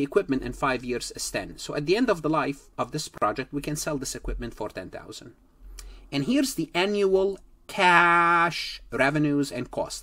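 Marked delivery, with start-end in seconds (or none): 6.1-9.7 cash said slow and loud, leaning on the word; "cash" drawn out and punched so students notice it; stress on cash. Real, not acted. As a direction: lean hard on "cash" and slow right down, louder than the rest. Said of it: "I said cash and emphasize the word cash".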